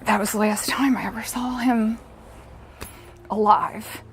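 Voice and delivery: real hushed grief; grief whisper. Real, not acted. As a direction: whisper it as if it hurts to say. Grieving mother repeats the line.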